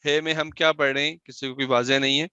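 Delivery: ordinary talk, nothing marked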